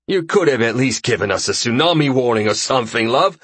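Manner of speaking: bantering complaint in character, rising at the end; grumbling Rembrandt figure. Acted. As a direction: grumble good-naturedly at a splasher.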